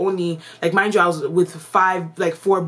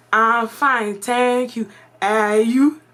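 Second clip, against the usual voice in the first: put-on formal textbook reply in a Ghanaian accent, higher; 5 semitones higher, 4 dB louder, much slower than usual. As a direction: answer politely in a Ghanaian accent. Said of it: "my accent had changed".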